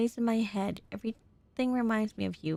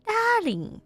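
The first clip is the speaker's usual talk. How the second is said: high, loud attempt at a French accent; 12 semitones higher, 6 dB louder than usual. Reader attempting the lover's "darling", mock French accent. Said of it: "how to do it in French accent".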